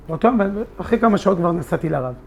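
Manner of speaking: normal talking voice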